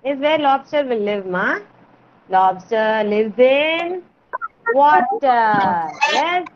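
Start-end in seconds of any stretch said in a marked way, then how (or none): 2.3-5.9 slow model answer. Shown as a demonstration. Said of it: "teacher models answer".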